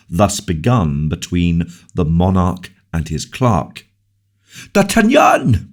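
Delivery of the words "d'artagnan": high, loud cry of delight; king seeing D'Artagnan return, crying out joyfully. A narrator's reading. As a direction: greet him with sudden joy. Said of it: "cried the king with evident joy".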